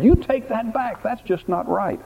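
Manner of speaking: put-on indignant kid squabble; frustrated retort. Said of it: "I'm sure Elijah wanted to say that".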